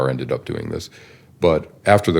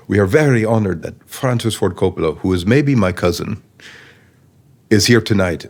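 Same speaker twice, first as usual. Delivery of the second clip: light put-on Italian accent, a bit higher and louder; 4 semitones higher, 3 dB louder than usual. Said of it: "I do my Italian accent".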